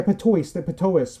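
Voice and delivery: "Patois" ending in "-oyce"; final S sounded. Real, not acted. Naming the dialect for a friend, "Patois" with a sounded S.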